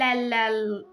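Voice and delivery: ordinary talk, nothing marked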